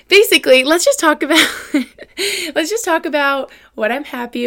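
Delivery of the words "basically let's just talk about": breaking into laughter, louder and higher; laughing. Real, not acted. Host laughing at her own tangent, laughing. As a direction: laugh the words out loud. Laughing while restarting the topic.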